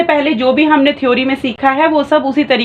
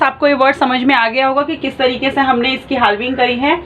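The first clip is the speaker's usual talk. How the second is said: usual speaking voice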